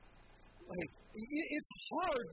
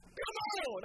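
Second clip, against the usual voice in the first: high, pumped-up call; excited shout; 10 semitones higher, 4 dB louder than usual. Retold or imitated. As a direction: fire up the room. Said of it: "you hear me do that".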